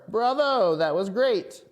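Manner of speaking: high, loud cheering crowd; excited cheering. Acted.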